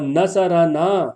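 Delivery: نصرنا slowly as na-sa-ra-naa; vowel on ر. Shown as a demonstration. Teacher contrasting "he helped us", نصرنا with voweled ر. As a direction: stretch نصرنا out, with "a" after ر. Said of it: "را پہ بھی زبر پڑھنا ہے".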